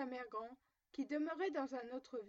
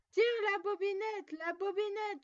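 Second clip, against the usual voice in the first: loud, higher call from bed; 7 semitones higher, 8 dB louder than usual. A narrator's reading. Grandmother in bed calling to the visitor, loud and high. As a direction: call out to the door. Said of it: "lui cria".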